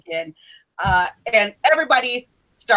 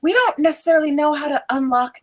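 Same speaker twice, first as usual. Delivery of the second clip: higher-pitched, earnest professor voice; brief the students in a raised pitch; professor figure, urgent; 6 semitones higher than usual. Acted. Professor asking upperclassmen for help with enchantments, urgent higher voice.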